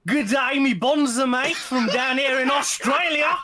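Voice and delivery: loud, high, hammed-up Australian; Australian accent. Guest answering the host's welcome, hammed-up Australian accent. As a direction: greet them in a broad, jokey Australian accent. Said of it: "did you go Australian on me".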